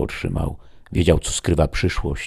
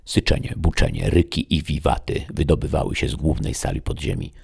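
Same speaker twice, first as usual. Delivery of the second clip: ordinary delivery, nothing marked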